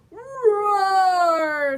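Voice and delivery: big loud growling roar; Yeti roar. Acted. Reading to kids, Yeti roaring loudly at Bird. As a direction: roar like a huge furry beast. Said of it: "said Yeti".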